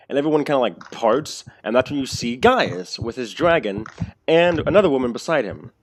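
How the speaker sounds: normal talking voice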